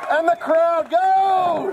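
slow, loud crowd cue; showman shout. Acted. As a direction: drag it out to cue cheers. Prompting applause from the crowd.

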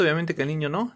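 ordinary talk

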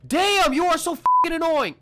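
loud, high irritated outburst; fed up and lashing out; exasperated yell. Real, not acted. Annoyed at pestering NPC.

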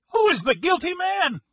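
very high, loud hysterical outburst; distraught widower screaming in grief and rage; frantic shrieking. A narrator's reading. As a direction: shriek every accusation, half out of your mind. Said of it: "he shrieked".

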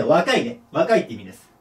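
usual speaking voice

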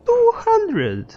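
exaggerated high-pitched exclamation; mock outrage. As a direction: squeal the price in comic disbelief.

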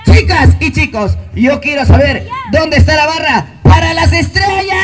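loud party-host hype, rising; cheerleading call. Real, not acted. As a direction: pump up the crowd for the stars team. Host leading team cheers.

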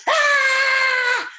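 a big shouted Ah; loud yell. Shown as a demonstration. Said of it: "you have to yell really loud".

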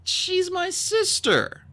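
falter, then get the words out slowly; Clay's sadness. A narrator's reading. Clay admits she is his sister, so sad. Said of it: "He sounded so, so sad".

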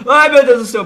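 sudden loud high yelp of fright; startled cry. Real, not acted. Gamer jolted by a surprise in the match, loud startled cry. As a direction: jump out of your skin, loud.

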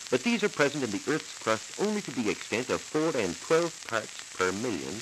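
ordinary delivery

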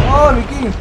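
loud, high cry to a teammate; sudden high-pitched shout; startled yell. Real, not acted. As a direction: yell it out mid-firefight. Calling out mid-firefight.

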